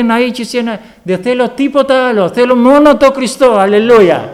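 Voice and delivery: fervent gospel preacher lifting toward a cry; preaching. Acted. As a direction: preach it with full fervour. Preacher building to full force.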